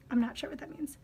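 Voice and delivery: soft whispered admission. Real, not acted. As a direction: confess it under your breath. Whispered aside.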